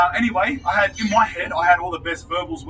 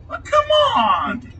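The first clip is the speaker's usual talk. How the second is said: exasperated plea; 5 dB louder, slower than usual. Real, not acted.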